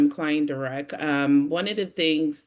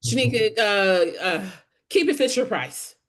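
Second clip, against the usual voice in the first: higher Brooklyn manager voice; Brooklyn accent; 6 semitones higher, 3 dB louder than usual. Retold or imitated. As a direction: Brooklyn boss giving advice. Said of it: "he had a heavy Brooklyn accent".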